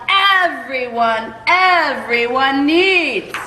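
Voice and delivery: everyone, everyone needs said slowly, each word stretched; stretched final defiant repetition; drawn-out emphasis. Acted.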